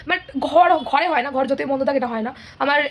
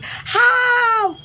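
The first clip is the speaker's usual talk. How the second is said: high drawn-out mock wail, louder; 9 semitones higher, 4 dB louder, much slower than usual. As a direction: wail it out in fake despair. Wail after listing examples. (উঁচু গলায় টেনে টেনে নকল কান্নার হাহাকার)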